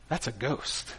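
low, very soft, understated dread; hushed realization. Acted. Preacher voices what disciples think on seeing Jesus, hushed realization. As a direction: drop it right down, almost under your breath. Quiet thought set against scream.

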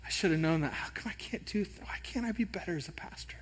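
unvoiced whisper of self-blame; whispered self-doubt. Pastor recalling church feedback, whispered self-doubt. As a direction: whisper it like a private inner fear. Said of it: "I think this thought".

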